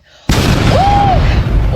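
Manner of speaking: very high loud gasp; child hearing dad come home, loud high gasp; dramatic gasp. Retold or imitated. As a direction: gasp big and high in alarm. Dad opens the door.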